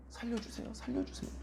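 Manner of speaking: breathy whispered begging; whispered plea. Retold or imitated. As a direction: plead in a whisper. Desperate prayer imitated. (속삭이며 애원하듯)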